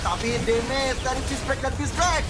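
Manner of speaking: high, excited play-by-play call; esports caster. Acted. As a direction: ride the teamfight, pitch climbing.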